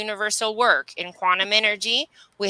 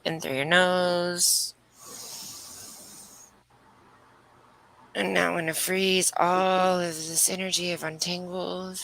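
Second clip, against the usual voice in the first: guided meditation; 3 semitones lower, 4 dB quieter than usual. Acted. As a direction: ease them through each breath, unhurried. Leads breathwork healing meditation.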